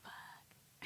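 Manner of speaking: breathless whispered fuck; whispered curse. Retold or imitated. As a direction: mouth the curse under your breath. Silently sitting with a truth.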